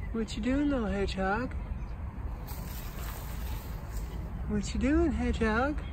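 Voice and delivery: soft, high pet-talk voice; high, gentle questioning lilt; cooing to animal. Real, not acted. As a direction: coo sweetly to a tiny hedgehog. Cooing to a hedgehog outdoors.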